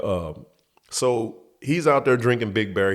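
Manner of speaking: ordinary delivery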